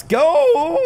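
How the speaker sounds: excited high shout that cracks. Real, not acted. Gamer urging on an attack, voice cracking. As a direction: yell it loud and high till the voice breaks. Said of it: "the voice crack right there".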